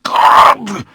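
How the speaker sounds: high, loud anguished cry; shouted out. Acted. Stabbed vampire cries out to God, loud and high. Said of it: "he shouted out".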